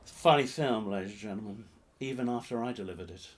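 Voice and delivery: ordinary talk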